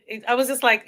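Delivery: ordinary talk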